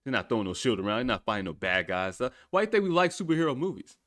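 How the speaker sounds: ordinary talk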